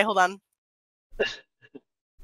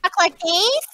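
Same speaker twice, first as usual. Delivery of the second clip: higher put-on voice, lifting at the end; 8 semitones higher than usual. Shown as a demonstration. Showing friends another way to talk, higher put-on voice. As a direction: show off a higher voice. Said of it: "And then I can talk".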